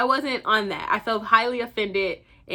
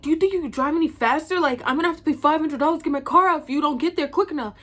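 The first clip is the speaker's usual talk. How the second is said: fast, higher-pitched mock urgency; rushing, demanding; 5 semitones higher, 4 dB louder, much faster than usual. Imitating her date rushing her to the tow lot, demanding. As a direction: mimic an impatient passenger, rapid-fire. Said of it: "he's, like, rushing me".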